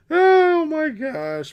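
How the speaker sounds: high, drawn-out laugh into oh my gosh. Real, not acted. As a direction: laugh at your own rant, stretching the words out. Laughs at own rant.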